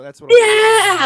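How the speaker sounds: loud, high excited shout. Real, not acted. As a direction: yell it out with delight.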